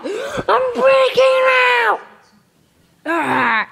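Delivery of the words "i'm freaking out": high, slow, stretched-out panicked shout; drawn-out high scream of I'm freaking out; panicked yell. Real, not acted. Building panic peaks.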